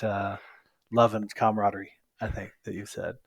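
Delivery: ordinary talk, nothing marked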